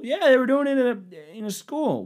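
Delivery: high, indignant mocking voice; hammed-up outrage in a high voice; mock outraged conservative. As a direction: play a riled-up Facebook uncle. Same mock character answers.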